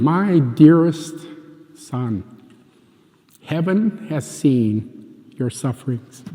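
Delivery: slow, solemn reading with long pauses; reading a letter aloud. Retold or imitated. Man reading a heavenly message meant for him, slow solemn reading. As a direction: read it out slowly, weighing each phrase. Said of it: "So I start reading".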